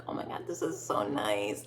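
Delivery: hushed gushing whisper; breathless private delight; inner whisper. Retold or imitated. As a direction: whisper it like a secret thrill. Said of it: "in my heart, I'm screaming and I'm like".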